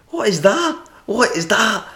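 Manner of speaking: mock a baffled Scottish customer, pitched high; Scottish accent. Scottish customer questioning his drink, high Scottish accent. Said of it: "these Scottish fellas came up".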